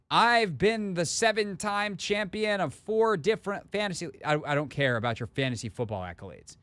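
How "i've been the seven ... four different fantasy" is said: mock braggy caller. Host mimics a boastful caller, mock smug and higher. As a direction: puff up like a bragging fantasy champ. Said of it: "the parameters here are not".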